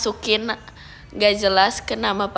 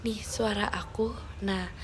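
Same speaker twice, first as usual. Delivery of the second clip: hushed, whispery soft voice; softly, half whispered; soft spoken; 7 dB quieter than usual. Shown as a demonstration. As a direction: show off a gentle, quiet voice. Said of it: "akunya harus soft spoken ya".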